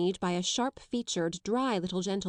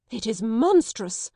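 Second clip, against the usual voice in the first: much louder, slow indignation; indignant; 3 semitones higher, 7 dB louder, slower than usual. Acted. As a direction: declare it outraged. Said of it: "she said".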